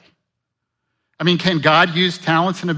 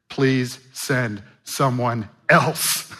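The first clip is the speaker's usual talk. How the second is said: slow begging; much slower than usual. Acted. Moses begging in scripture.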